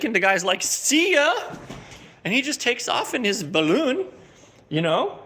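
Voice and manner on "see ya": mock wizard farewell. Wizard flees in balloon.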